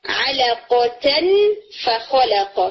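drawn-out nasal n hidden before فخلق; ikhfa demo. Shown as a demonstration. Teacher demonstrating tajweed ikhfa, slow and nasal. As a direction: glide the n of علقتن slowly into the f. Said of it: "for example".

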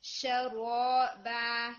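شرابا drawn out very slowly, shin kept open; clear shin. Shown as a demonstration. Teacher modelling شرابا for her student. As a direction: stretch شرابا with a clean sha. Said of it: "شین آپ کا را کے فیکٹ میں چلا گیا".

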